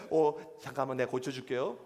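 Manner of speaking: low, softened offer of help; sudden gentle turn. Acted. Angry man suddenly turns kind. (갑자기 낮고 부드럽게)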